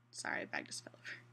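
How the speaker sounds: a quick hushed apology under the breath; whispered aside. Real, not acted. Speaker apologising to viewers for a fallen bag, whispered. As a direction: drop to a whisper to excuse the noise. Apology for a noise mid-recording.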